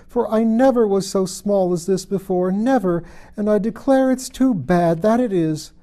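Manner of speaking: put-on high, loud childish lament, slowed. A narrator's reading. Alice voiced in read story.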